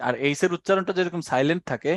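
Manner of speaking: normal talking voice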